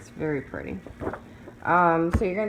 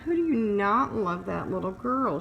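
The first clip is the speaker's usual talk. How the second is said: cooing; 5 semitones higher than usual. Real, not acted. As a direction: coo over something adorable. Cooing over a cute picture.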